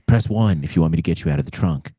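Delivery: low sultry hotline menu; sex line voice. Acted. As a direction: purr it like a recording. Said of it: "my sex line voice".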